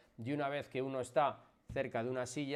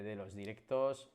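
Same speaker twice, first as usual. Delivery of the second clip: usual speaking voice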